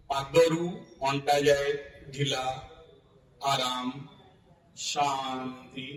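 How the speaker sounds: low-key, even meditation voice with long pauses; guided yoga nidra. Real, not acted. Yoga teacher guiding students to relax from the feet up, guided meditation. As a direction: guide them through the body, unhurried.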